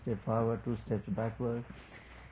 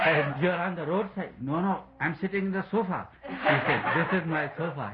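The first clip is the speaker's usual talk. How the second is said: higher voice, amused through the quotes; laughing; 6 semitones higher, 3 dB louder than usual. Real, not acted. Speaker retelling son and drunk father arguing over sofa, laughing. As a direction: act out the exchange while trying not to laugh.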